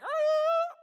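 a high, loud scream of alarm; scared scream. Retold or imitated. Rider yelling as the horse bolts into the barn, screaming. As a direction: shriek as the horse reaches the barn. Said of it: "just like".

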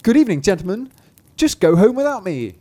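high, loud taunt from a little trickster; mocking cry. A narrator's reading. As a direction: crow at them, high and cheeky. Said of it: "he cried to them, and mocked them".

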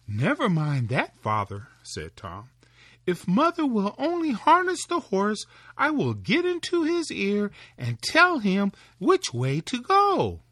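put-on high, loud boyish cry; eager little Tom. Tom offering to bring the cart, high and eager. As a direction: call out eagerly to father.

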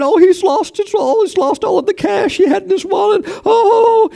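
very high crying wail, half-shouted; high theatrical mock crying and shouting. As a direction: ham up the sobbing of the mourners. Onlookers wailing in story.

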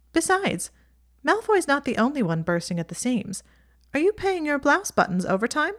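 Ginny teasing. A narrator's reading.

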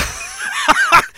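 real, delighted high cackle. Real, not acted. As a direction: burst out in high-pitched laughter. Laughs at the listener's name.

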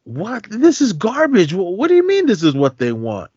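high, disbelieving "this is garbage"; incredulous outrage. Retold or imitated. Monk hears the book is loved, high incredulous outrage. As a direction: push way up in shocked protest. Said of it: "And he was like".

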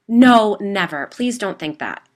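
loud, urgent pleading, slowing at the end. A narrator's reading. Gwen begging her friend not to think it a prank, loud. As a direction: beg her to believe you. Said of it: "she pleaded".

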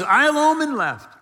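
loud anguished protest; despairing cry. Retold or imitated. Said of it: "He's, he's lonely".

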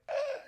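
high strained choking noise; imitated choking. Storyteller showing friends how he choked, mock choking gasp. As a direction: act out a chest closing in. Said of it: "it's just closing in".

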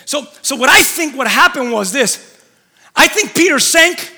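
usual speaking voice

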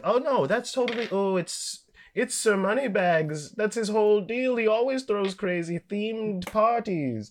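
high, slow, airy fake-casual voice; fake cheerful cover story. Guests covering up a murder, high and breezy. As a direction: chirp it like nothing's wrong. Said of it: "they're gonna play like".

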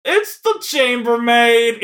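high, loud, drawn-out mock reply; hammed-up maid answering the phone, slowly; imitated cleaning lady. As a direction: stretch it out high like a comic chambermaid. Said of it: "she says it exactly how I just said it".

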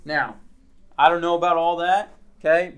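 usual speaking voice

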